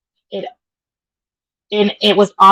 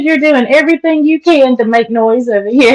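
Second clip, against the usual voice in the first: high, raised voice; calling out; 5 semitones higher, 3 dB louder than usual. Real, not acted. Daughter teasing mama about making noise, calling out. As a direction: call it over to someone out of the room. Calling to her mother across room.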